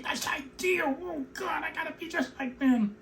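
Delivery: high mocking voice, hammed up; mocking put-on voice. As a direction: parody the eager conformist. Mocking the assimilation ideal.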